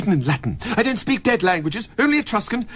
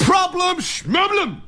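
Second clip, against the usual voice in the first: dismissive scoff; 10 semitones higher, slower than usual. Acted. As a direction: wave the problem away with a shout. Doctor brushing off patient's problem.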